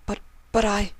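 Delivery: flustered high mare voice; stammering Octavia. Acted. Octavia's voice in the story.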